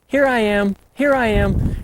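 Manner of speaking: high little calf voice; answering calf. Retold or imitated. Calf answering its mother, high and light. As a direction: answer back like a small calf. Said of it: "the calf answering back".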